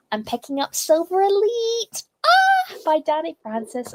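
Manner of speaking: high-pitched, gleeful book reveal; bursting with excitement, pitch soaring; real excitement. Real, not acted. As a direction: announce it with a thrilled little squeal. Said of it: "and I am so excited".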